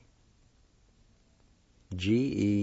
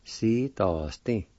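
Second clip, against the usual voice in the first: gá nó Ski, si, do, sti, said slowly in parts; broken down slowly; much slower than usual. Shown as a demonstration. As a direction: take it slow and sound it out. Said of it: "You might want to say".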